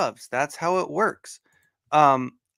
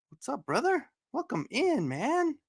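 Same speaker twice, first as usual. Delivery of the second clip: high, softer squeaky welcome; playful high greeting; 11 semitones higher, 6 dB quieter than usual. Pretend. Streamer welcoming a brother into the chat, playful high greeting.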